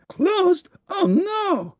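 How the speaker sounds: very high Oh no; dismayed cry. Acted. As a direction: despair at a closed shop. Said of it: "he cried".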